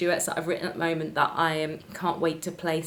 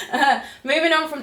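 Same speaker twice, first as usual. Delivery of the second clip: laughing; 9 semitones higher, 3 dB louder than usual. Real, not acted. Laughing at cat video memory.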